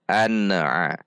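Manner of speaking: an-a with N bouncing into a tiny extra vowel; bounced N. Shown as a demonstration. Teacher shows wrong reading, an-a bounced. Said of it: "Atau dipantul".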